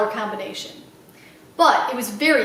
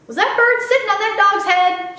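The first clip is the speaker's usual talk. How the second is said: put-on little girl voice; 7 semitones higher, 4 dB louder than usual. Little girl Sweetie Pie.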